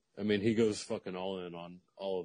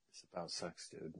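put-on hushed older-brother reveal; 2 semitones lower, 11 dB quieter than usual. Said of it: "He was like".

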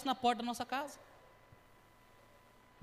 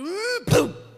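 loud mock crunch of a car hitting; high loud imitated crash noise; crash sound; 7 semitones higher, 12 dB louder than usual. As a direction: make the bump of the crash with your voice.

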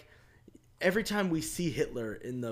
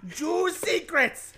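put-on German accent; 9 semitones higher, 8 dB louder than usual. Host reading the plot in a hammed-up German accent. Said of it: "the German accent".

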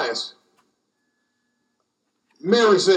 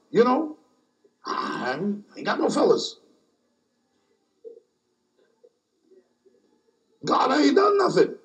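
lightly voiced Mary; 3 semitones higher, 3 dB quieter, faster than usual. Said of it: "she kinda approached it like that".

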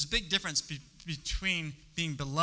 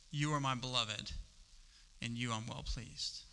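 tender voice of God; 4 semitones lower, 9 dB quieter than usual. Acted. Quoting God's tender words.

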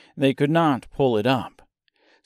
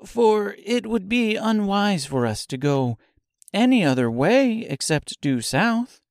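Dorothy's voice; 10 semitones higher than usual. A narrator's reading. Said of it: "said Dorothy".

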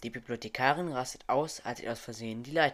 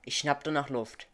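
usual speaking voice

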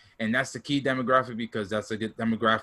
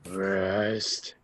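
wrestling promo; 3 semitones lower, 3 dB quieter, much slower than usual. Pretend. Undertaker catchphrase in promo bit.

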